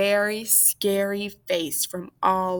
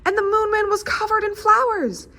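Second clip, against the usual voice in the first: lively storybook read-aloud; story reading; 5 semitones higher, 3 dB louder than usual. A narrator's reading. Librarian reading the picture book to kids, lively storybook voice. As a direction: read it to little kids with wonder.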